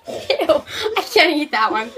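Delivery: laughing, grossed out; disgusted laughing. Real, not acted. Disgusted laughing at barf bean.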